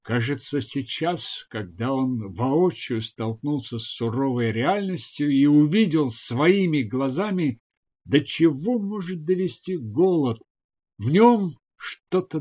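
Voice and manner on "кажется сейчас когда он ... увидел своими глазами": ordinary delivery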